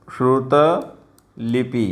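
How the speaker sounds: श्रुतलिपि said very slowly with a clear shr; correct form, slowly. Shown as a demonstration. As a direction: spell out श्रुतलिपि syllable by syllable. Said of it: "सही answer C होगा". (श्रुतलिपि साफ़ और धीरे)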